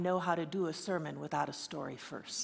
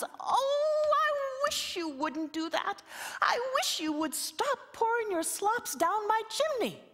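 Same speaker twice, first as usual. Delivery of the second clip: high, thin little-creature voice; small high fairy-folk plea; wee voice; 4 dB louder, slower than usual. Acted. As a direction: squeak it out like a tiny fellow under the wall. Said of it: "that little voice comes".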